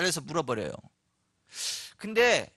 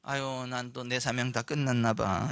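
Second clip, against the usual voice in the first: low, slow, soft lament; mock despondent; 6 semitones lower, 4 dB quieter, slower than usual. Sick believer giving up on their mission, low despondent voice. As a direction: sigh it out as if all is over.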